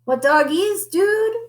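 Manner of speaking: high, cool-dude quip; very high, breezy dude voice; quipping. A narrator's reading. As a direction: toss it off like a laid-back surfer. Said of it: "quipped Don Diego".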